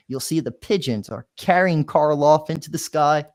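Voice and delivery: plain reading voice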